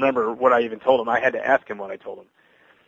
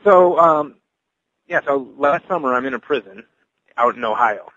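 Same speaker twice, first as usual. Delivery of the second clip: ordinary talk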